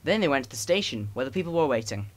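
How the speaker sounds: ordinary delivery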